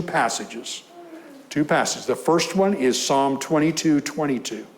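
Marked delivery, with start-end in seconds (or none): none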